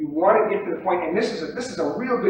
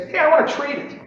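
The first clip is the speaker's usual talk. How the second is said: raised, loud inviting call; eager to trade; 11 semitones higher, 5 dB louder than usual. Shown as a demonstration. Trader inviting the pit to deal, eager. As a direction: throw it out as a challenge. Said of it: "something in your voice that says".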